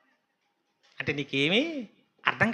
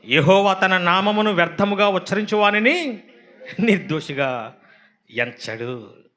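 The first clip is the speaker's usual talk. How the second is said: slow, loud preaching of the verse; solemn scripture reading; 5 dB louder, slower than usual. Acted. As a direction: proclaim the commandment with weight. Reads the commandment aloud. (నెమ్మదిగా గంభీరమైన వాక్య పఠనం)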